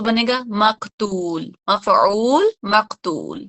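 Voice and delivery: مقتول and مفعول drawn out slowly side by side; slow pattern demo. Shown as a demonstration. Teacher matches مقتول to مفعول for class. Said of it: "مفعول کے وزن پہ لاؤں".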